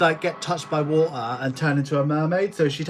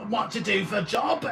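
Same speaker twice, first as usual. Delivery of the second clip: rough woman; 6 semitones higher than usual. Retold or imitated. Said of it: "and then she goes".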